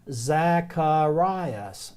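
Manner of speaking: Zacharias stretched very slowly, zak-uh-RYE-us; slow Greek name. Shown as a demonstration. Teacher introducing a name, sounding Zacharias out slowly. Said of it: "Second one is a name again".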